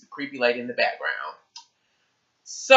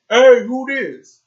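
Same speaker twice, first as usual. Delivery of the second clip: high, loud, slow mock dad voice; imitating girl's dad; 11 semitones higher, 8 dB louder, much slower than usual. Girl's dad answering a kid's call, mock high loud voice. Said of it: "He was like".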